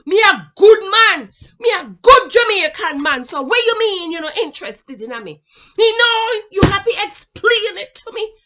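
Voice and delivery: put-on loud, high Jamaican accent, demanding an explanation; Jamaican man impression. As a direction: whine and insist like a pushy Jamaican suitor in a Jamaican accent. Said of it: "That's how they talk".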